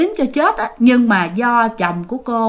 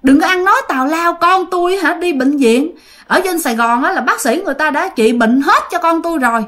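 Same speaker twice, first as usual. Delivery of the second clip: high, indignant scolding that keeps climbing; father scolding the stranger out of his house, high and indignant; retold scolding; 7 semitones higher than usual. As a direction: chase the old man out, pitch rising. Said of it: "Xong cái ba chửi quá trời luôn".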